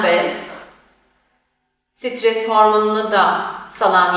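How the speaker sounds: unhurried yoga nidra voice with long pauses; guided meditation. Acted. Yoga teacher guiding resting listeners through body and imagery, guided meditation. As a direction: settle into an even, restful guiding tone, leaving space between phrases.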